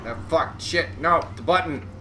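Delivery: sudden alarmed cry, pitch jumping up; panicked surprise. Real, not acted. Gamer hitting the wrong button, alarmed outburst. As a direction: blurt it out as you hit the wrong key.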